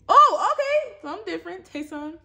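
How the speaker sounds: very high and loud, bursting with relief; relieved delight. Real, not acted. Vlogger finally pulling a new card, high relieved cheer. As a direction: cheer that it's finally different.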